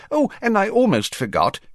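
high, fussy official voice; Fudge voice. Acted. Fudge answering the Prime Minister in a high fussy voice. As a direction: play him as a flustered minister.

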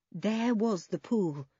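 ordinary delivery, nothing marked

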